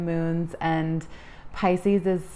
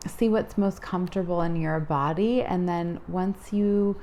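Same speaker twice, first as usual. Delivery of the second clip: guided meditation. Acted.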